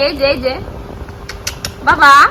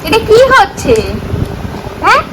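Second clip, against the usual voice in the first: high sing-song parent voice; 8 semitones higher than usual. Real, not acted. Parent addressing baby playfully.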